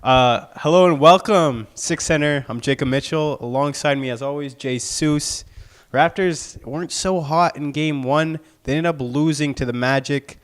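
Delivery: loud show-host opening; sports anchor. Acted. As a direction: welcome viewers like a TV host.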